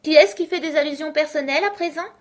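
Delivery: high, loud, quick gloating question; triumphant. A narrator's reading. Hatter catching Alice making personal remarks, triumphant. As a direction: pounce on it in triumph. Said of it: "demanda le chapelier d'un ton de triomphe".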